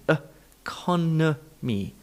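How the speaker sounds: economy in slow syllables, uh-CON-uh-mee; schwa demonstration. Shown as a demonstration. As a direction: pull economy apart, reduced first vowel. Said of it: "add in the all-important schwa".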